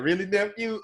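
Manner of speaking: put-on a teasing older uncle; imitating Earl Campbell.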